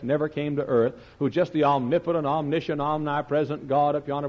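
normal talking voice

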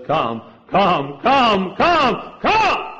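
the Spirit's plea rising to a shout; pleading come, building. Acted.

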